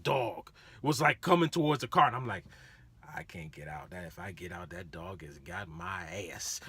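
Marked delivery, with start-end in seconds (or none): 3.2-6.6 dropped low and quiet, scared to move; hushed muttering. Retold or imitated. Driver hiding in car from big dog, hushed and scared. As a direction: mutter it under your breath, afraid of the dog.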